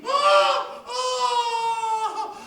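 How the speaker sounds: very high, loud, wailing cry; woman's high cry. Acted. Wife cries out at the climax, shrieking high. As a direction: shriek it out in rapture. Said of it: "la femme s'écrie".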